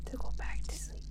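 soft breathy night-time whisper. Real, not acted. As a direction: hush so no one wakes. Hushed night feeding.

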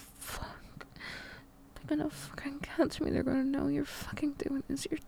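aroused hushed whisper; fighting to stay quiet; straining to whisper. Acted. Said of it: "hard to keep my voice down".

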